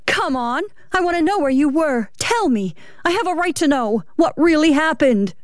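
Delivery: high, urgent demands without shouting; angry. A narrator's reading. Wife interrogating her retreating husband, angry and high. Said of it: "flashed with anger".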